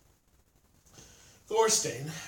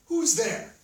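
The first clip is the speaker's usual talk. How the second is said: high, loud challenge; raised-voice "who goes there"; calling out; 10 semitones higher, 6 dB louder than usual. A narrator's reading. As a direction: call out sharply to an unseen visitor. Startled Thorstein calls into the dark.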